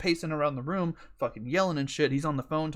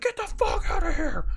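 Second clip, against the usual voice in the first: loud, high-pitched angry yell; furious screaming; 5 dB louder than usual. Retold or imitated. Dad ordering kids out of the room, screaming. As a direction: shake the walls with this shout. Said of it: "just like that right there".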